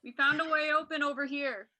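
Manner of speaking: calling across a big room; yelling across the room. Pretend. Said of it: "yell across the room".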